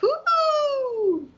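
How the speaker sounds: high, drawn-out mock fangirl woo-hoo; mock swooning cheer. Acting out the gushing fan she isn't, mock excited. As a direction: let out a high, sing-song cheer. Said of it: "I'm not like".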